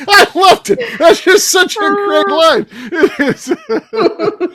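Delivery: high, slowed words through real laughter; laughing. Real, not acted. Co-host delighted by the line just quoted, laughing. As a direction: crack up while speaking.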